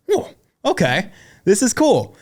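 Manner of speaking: whoa, genuinely impressed by the setup; amazed. Retold or imitated.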